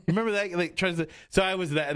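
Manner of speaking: normal talking voice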